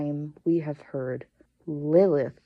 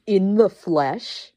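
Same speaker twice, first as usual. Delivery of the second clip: slow, louder dramatic reveal; 4 semitones higher, 7 dB louder, slower than usual. Acted. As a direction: savor the words slowly. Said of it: "after they said "in the flesh" a couple of times".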